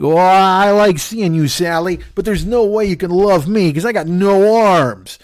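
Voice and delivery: slow, whiny mock pity voice; mock mopey veteran. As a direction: drag it out like a self-pitying sad sack. Mocking the armless veteran's lines.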